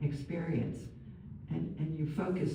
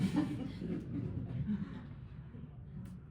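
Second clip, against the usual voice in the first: soft, high laughing; laughter; 7 semitones higher, 8 dB quieter than usual. Real, not acted. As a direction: let a quiet laugh run on after the joke. Laughing at her own joke.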